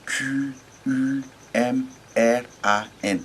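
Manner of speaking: Q U M R A N spelled slowly, letter by letter. Shown as a demonstration. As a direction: spell out Q U M R A N carefully. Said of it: "on nous présente Qumran". (épeler Q U M R A N lentement, lettre par lettre)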